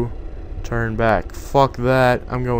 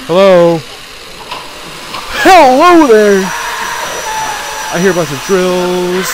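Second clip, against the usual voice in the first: calling out; 7 semitones higher, 9 dB louder than usual. Real, not acted. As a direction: belt hello into the empty hall. Calling into dark hallway.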